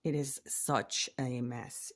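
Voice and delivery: ordinary talk, nothing marked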